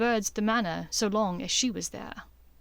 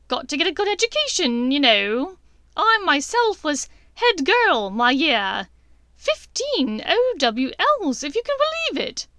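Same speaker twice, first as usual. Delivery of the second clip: manic Bellatrix; 7 semitones higher, 7 dB louder than usual. A narrator's reading. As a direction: gloat like a mad witch.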